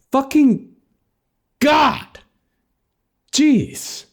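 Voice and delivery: high, exasperated groan of disbelief; exasperated outburst. Acted. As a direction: throw up your hands in disbelief. Exasperated revelation.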